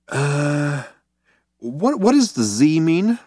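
slow, high, hesitant question; dim-witted puzzlement. A narrator's reading. Zaphod seems stupid to Trillian, slow and puzzled. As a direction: play it slow on the uptake.

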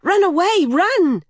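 high, loud shout of alarm; urgent yell. A narrator's reading. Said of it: "she yelled to Horse".

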